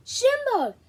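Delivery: high, loud happy cry of a name; joyful shout. Acted. Friend spots Jimbo across the room, joyful shout. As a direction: call out in delight.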